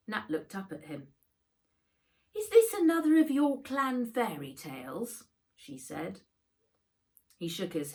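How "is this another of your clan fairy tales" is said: put-on higher, louder young girl asking, doubtful; girl's voice. A narrator's reading. Nat questioning the old clan tale, higher girl's voice. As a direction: lift into Nat's voice, half teasing.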